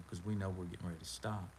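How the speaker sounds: usual speaking voice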